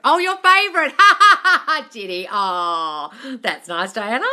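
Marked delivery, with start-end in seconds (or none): 0.0-1.9 much higher and louder, playful sing-song; teasing echo. Retold or imitated. Host echoing the son's quip, playful tease. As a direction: tease it back like a proud kid. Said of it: "He said".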